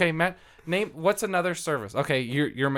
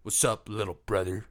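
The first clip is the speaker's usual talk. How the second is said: a hint of Vin Diesel, a touch lower; lightly voiced tough big brother greeting; mild Vin Diesel impersonation; Vin Diesel impression; 7 semitones lower than usual. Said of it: "Vin Diesel's like".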